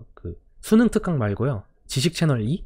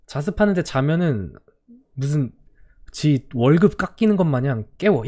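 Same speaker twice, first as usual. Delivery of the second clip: ordinary delivery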